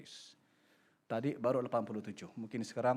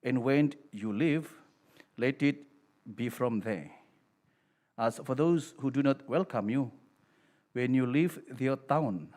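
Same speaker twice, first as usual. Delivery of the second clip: solemn, louder priestly reading; Gospel proclamation; 5 dB louder than usual. Acted. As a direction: proclaim it to the whole congregation.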